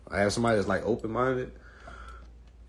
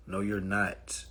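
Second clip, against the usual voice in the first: usual speaking voice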